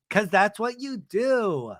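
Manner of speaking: retold accusation, pitched high with drawn-out words; mock accusation. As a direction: act out a whiny comeback, slowly. Acted accusation in retold quarrel.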